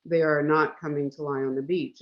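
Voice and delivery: usual speaking voice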